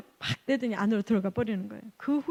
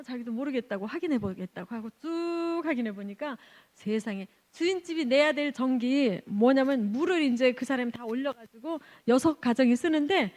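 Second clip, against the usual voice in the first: normal talking voice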